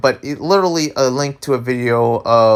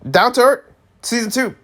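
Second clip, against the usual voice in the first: normal talking voice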